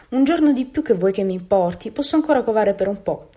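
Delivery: a touch of stubborn resolve; resolute. A narrator's reading. Mother duck insisting she will keep brooding, mildly resolute. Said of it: "rispose l'anatra ben decisa".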